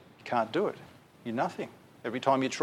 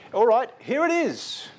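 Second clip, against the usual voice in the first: mock voice of God; 9 semitones higher, 5 dB louder, slower than usual. God voiced granting approval.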